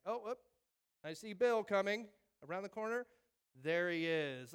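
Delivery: playful announcing. Pretend. Imagined far-seeing announces Bill.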